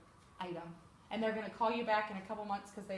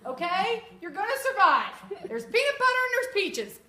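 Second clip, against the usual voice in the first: much higher and louder, emphatic; fired-up pitch; 7 semitones higher, 9 dB louder than usual. Real, not acted. Consultant hyping the can opener to her team, loud excited. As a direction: sell it like a rallying cry.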